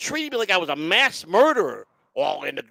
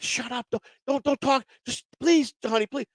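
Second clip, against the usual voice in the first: higher, pleading hush; pleading wife; 9 semitones higher than usual. Retold or imitated. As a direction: beg him to stop talking. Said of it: "she's like".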